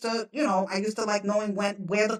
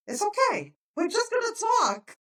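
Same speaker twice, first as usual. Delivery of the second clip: high-pitched, soothing voice; calm someone down in a raised, placating pitch; retold reassurance; 9 semitones higher than usual. Retold soothing of panicked woman.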